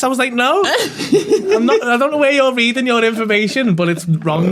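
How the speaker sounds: wheezing with laughter; laughing. Real, not acted. Laughing, near crying.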